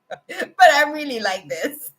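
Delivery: usual speaking voice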